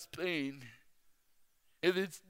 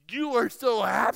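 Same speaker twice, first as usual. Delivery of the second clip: loud, high mock-incredulous exclamation; astonished old lady; 5 semitones higher, 9 dB louder than usual. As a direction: blurt it out, imitating a baffled stranger. Imitating elderly stranger's astonished outburst.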